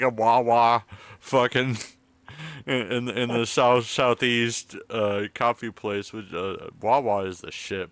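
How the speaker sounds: usual speaking voice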